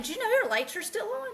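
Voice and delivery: usual speaking voice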